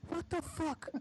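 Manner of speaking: high, startled cry of disbelief; shocked outburst. A narrator's reading. Narrator seeing a hand emerge, shocked high outburst.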